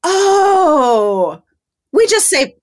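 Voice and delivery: high, loud, long dawning "oh"; mock realisation. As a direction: act out the penny dropping.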